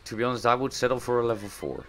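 ordinary talk, nothing marked

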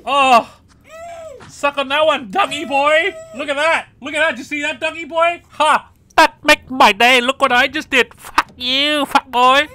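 high, loud victory yelling; triumphant gloating. Real, not acted. Streamer gloating at Dougie boy after reverse karma, loud triumph. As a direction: crow over the rival's downfall.

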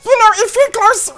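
high, loud cartoon voice; Meatwad impression. Player voices homeboy suggesting a nickname, high Meatwad voice.

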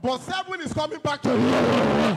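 loud, exultant prophetic shouting; real preacher's shout with drawn-out endings; full-force preaching. Real, not acted. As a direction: proclaim it loudly, stretching the final words.

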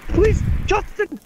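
high panicked cries for help; panicked screaming. Real, not acted. Teammate being killed, panicked pleas.

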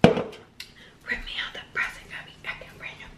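breathy whispered lyric, fast; whispered mock song line.

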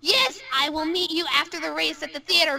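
normal talking voice

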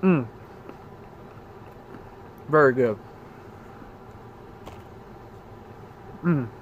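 muffled closed-mouth hum; eating while speaking; mouth full, chewing. Real, not acted. Seen 2: Puts cookie in mouth at -2, closed-mouth chewing through labelled words.

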